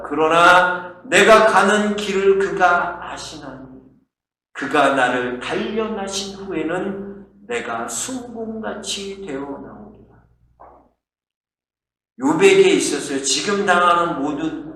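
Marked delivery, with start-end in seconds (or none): 0.0-10.2 slow, solemn scripture recitation; Job's declaration. Acted. Preacher quoting Job's verse, slow declaration. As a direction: proclaim it slowly, opening loud, sinking at the end. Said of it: "욥이 이렇게 말합니다".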